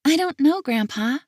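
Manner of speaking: put-on high, innocent child voice; little girl voice. Little girl answering her grandpa, high and innocent. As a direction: pitch it up like a small girl. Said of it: "she says honestly".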